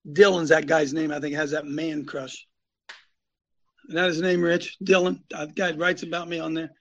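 normal talking voice

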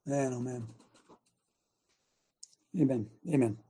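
breathless, catching his breath; out of breath, winded between words. Real, not acted. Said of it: "Let me catch my breath there a second".

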